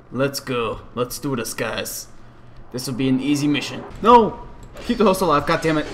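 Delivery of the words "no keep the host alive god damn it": raised, higher voice, urgent; urgent scolding. Real, not acted. Gamer telling teammates to keep the host alive, urgent shouting. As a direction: call out orders to teammates mid-fight.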